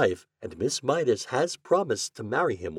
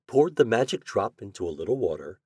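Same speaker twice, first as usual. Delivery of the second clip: ordinary delivery, nothing marked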